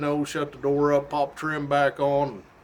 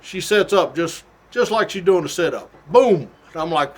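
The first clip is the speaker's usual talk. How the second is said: normal talking voice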